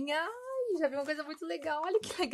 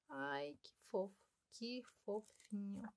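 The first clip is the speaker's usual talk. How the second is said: much softer, hushed cooing; 2 semitones lower, 12 dB quieter than usual. Real, not acted. Adoring a cute notepad, a soft hushed coo. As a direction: coo under your breath.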